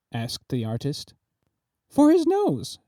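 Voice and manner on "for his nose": a much higher cry; shouting. A narrator's reading. Duchess calling out to the artist, high shout. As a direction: call it out high and sharp. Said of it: "shouted her Grace".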